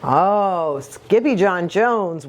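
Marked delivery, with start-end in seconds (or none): none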